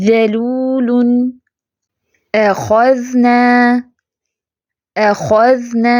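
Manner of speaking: اخذنا recited very slowly, heavy خ, light ذ held still; slow, careful recitation of each word; tajweed demo. Shown as a demonstration. Teacher recites examples slowly for students. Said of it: "خا fat ہے، باقی سارے light letter ہیں".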